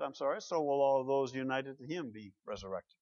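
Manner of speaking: ordinary talk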